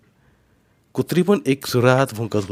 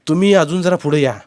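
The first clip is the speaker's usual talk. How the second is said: raised, higher voice; calling across; 6 semitones higher, 5 dB louder than usual. A narrator's reading. Boy asking uncle to come closer, calling across. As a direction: pitch it over the gap. Nephew calls across the stream.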